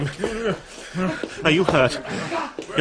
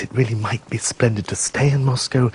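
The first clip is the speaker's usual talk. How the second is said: ordinary talk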